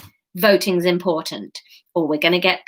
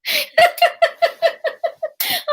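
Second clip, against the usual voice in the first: high giggle. Real, not acted. Laughing at own story.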